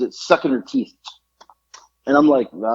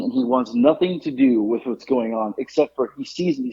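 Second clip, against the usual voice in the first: ordinary talk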